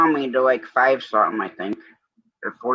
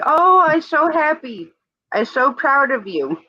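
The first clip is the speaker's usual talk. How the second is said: put-on higher, gushing take on the doctor's accented voice; lightly accented, delighted praise; imitating the doctor's accent; 13 semitones higher than usual. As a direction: play the proud little doctor, beaming, pitched up high. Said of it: "he's got that, like, accent".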